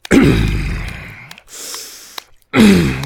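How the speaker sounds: long loud straining groan; loud climax groan. Acted. Lover at the peak with his partner, loud groan. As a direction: hold one big release groan.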